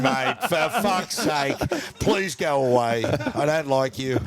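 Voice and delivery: slow, drawn-out sing-song begging; mock plea with a laugh; slow pleading. Begging the guest to leave.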